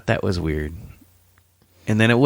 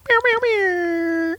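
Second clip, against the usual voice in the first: very high, drawn-out mock mewling; teasing high-pitched nyah-nyah; mocking meows; much slower than usual. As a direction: mimic a complaint in squeaky, stretched meows. Mocking back in a playful spat.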